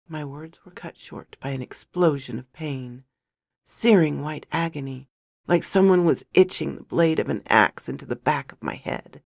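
usual speaking voice

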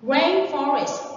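"Rainforest" stressed on RAIN; slow model of "Rainforest", weight on the first syllable. Shown as a demonstration. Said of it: "the stress is on the first one".

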